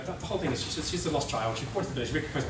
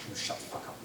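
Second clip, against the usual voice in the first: mock hush; 8 dB quieter than usual. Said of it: "And everyone's like".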